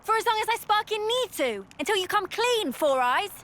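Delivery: heated, high-pitched retort; indignant. Acted. Arguing with a companion who mocked them, indignant. Quarrel, demanding he come clean.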